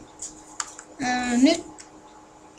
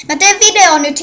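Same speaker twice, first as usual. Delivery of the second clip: higher, louder, calling to someone off-mic; real raised voice; 6 semitones higher, 9 dB louder than usual. Real, not acted. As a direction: tell the person off-mic you're recording. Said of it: "taisitte kuulla nyt". (kovaa ja korkealta, huutaen toiselle)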